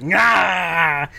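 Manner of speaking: drawn-out acted "nah" roar; wrestler's answering holler; Shark Attack's snarl. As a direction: growl back at the challenger. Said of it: "Shark Attack's like".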